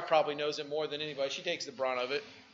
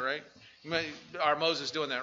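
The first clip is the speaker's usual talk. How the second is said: ordinary delivery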